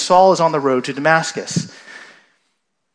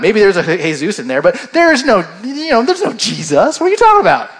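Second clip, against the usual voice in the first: indignant, sassy, pitched way up on the questions; a cheeky know-it-all talking back; smart aleck; 5 semitones higher, 4 dB louder, faster than usual. Retold or imitated. Saul talks back to Jesus like a smart aleck, sassy. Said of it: "he could be like".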